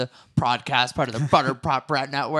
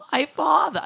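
failed attempt; 12 semitones higher, 4 dB louder than usual. Shown as a demonstration. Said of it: "See, I can't even do it".

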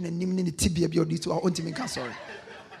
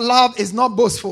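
loud, emphatic congregational prompt; said aloud; 8 dB louder than usual. Shown as a demonstration. Preacher urging congregation to repeat after him, said aloud. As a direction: project it for the whole room to repeat. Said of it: "Say it aloud".

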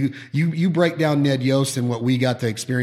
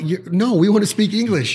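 Italian locals replying; 7 semitones higher, 3 dB louder than usual. Retold or imitated. Italians reply in retold exchange.

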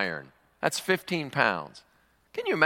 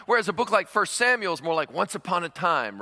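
ordinary delivery

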